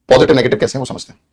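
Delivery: ordinary delivery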